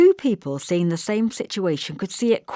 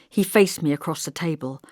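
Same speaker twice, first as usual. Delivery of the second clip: ordinary delivery, nothing marked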